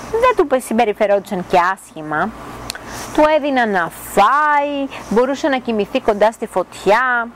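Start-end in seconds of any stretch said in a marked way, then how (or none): none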